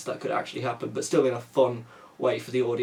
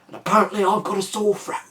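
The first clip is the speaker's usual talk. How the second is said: hoarse, half-whispered tough-guy threat, pitched higher; mocking a whispery action star with a sore throat; Jason Statham impression; 7 semitones higher than usual. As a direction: rasp it out like a gravel-voiced action hero who's lost his voice. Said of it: "sounds like he's whispering to people all the time".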